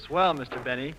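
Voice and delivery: ordinary talk, nothing marked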